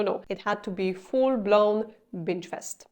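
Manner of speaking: ordinary delivery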